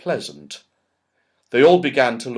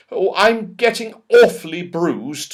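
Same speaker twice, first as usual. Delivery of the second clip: raised, high complaint; shouted complaint; 8 semitones higher, 6 dB louder than usual. Acted. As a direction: call out how sore you are. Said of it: "shouted Beth".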